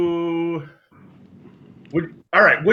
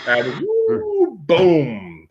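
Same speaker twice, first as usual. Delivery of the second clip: falling whoosh; 2 semitones higher, much slower than usual. Retold or imitated. Retelling a fatal fall.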